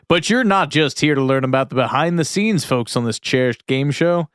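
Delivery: ordinary talk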